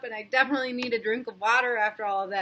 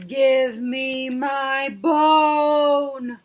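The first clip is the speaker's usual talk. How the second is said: very slow, louder eerie ghost moan; louder ghost call; 9 dB louder, much slower than usual.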